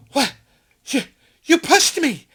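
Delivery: gasp, then stammer it high and loud; affronted, sputtering imitation of an accuser; mock indignant shock. Woman telling off the person who pushed through, mock outrage.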